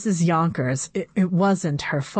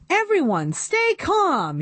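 yelled; 11 semitones higher than usual. A narrator's reading. Teacher calls out to the panicking class, high yell. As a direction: call out over the chaos. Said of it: "yelled the beautiful Missus Cooney".

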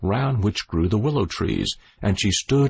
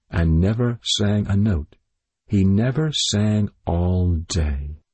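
plain reading voice

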